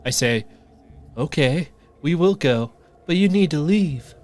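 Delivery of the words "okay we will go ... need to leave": put-on high, louder nervous-teen reply; voice gone higher. Teen answers the stranger at the tent, voice gone higher. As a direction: pitch it up and push it out. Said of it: "See, his voice went higher".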